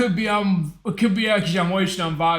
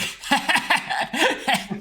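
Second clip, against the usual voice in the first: short burst of laughter; laughing; 7 semitones higher than usual. Real, not acted. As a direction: laugh at your own joke. James Bond drink joke.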